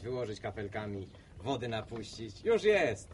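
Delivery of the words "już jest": loud, much higher burst; triumphant exclamation. Acted. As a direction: cap the plan with a triumphant cry. Triumphant end of pool plan.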